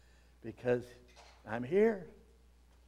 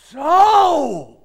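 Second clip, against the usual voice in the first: So! shouted much higher and louder; emphatic shout; 12 semitones higher, 16 dB louder than usual. Shown as a demonstration. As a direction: fire off So! as pure intensity. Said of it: "It is saying".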